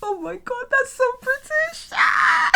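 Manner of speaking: high, squealing mock horror; shocked squeal. Real, not acted. Viewers hear her squeal at saying quid. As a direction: squeal at her own Britishness. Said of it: "Did I just say quid?".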